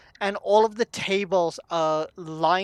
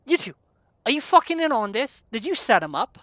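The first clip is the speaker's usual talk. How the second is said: higher, heated questioning; raised-voice suspicious barkeep; angry accusation; 6 semitones higher, 4 dB louder, faster than usual. Acted. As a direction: round on the accomplices. Barkeep rounds on two strangers.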